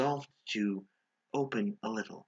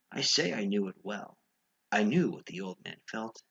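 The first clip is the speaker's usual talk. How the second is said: plain reading voice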